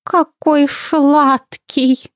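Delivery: high, very slow creature coo; breathy adoration. A narrator's reading. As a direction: gush with a breath, drawing out every word. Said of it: "с придыханием". (с придыханием, умильно и тягуче)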